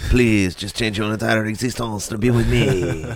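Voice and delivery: hammed-up French plea; horrible French accent. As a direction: beg like a lovelorn Frenchman. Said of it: "That's a horrible French accent".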